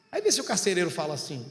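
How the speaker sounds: ordinary talk, nothing marked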